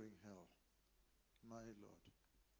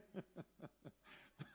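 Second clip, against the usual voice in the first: quiet airy chuckle; soft breathy laugh; 7 dB quieter than usual. Real, not acted. Laughs at his own image.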